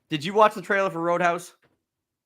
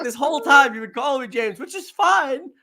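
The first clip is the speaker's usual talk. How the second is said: higher, whiny retelling of the bum; bum's complaint; 8 semitones higher, 4 dB louder than usual. Retold or imitated. Bum telling James he got the name wrong, whiny and high. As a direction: pitch up and let it whine.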